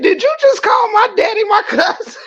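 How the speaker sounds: very high, squealing laugh-cry; losing it completely mid-quote; crying with laughter. Real, not acted. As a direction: shriek the line through helpless laughter. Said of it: "how wet my face is right now from crying".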